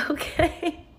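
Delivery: high rising okay with a laugh; laughing, high-pitched imitation; doubtful okay. Acted doubtful reply to false name.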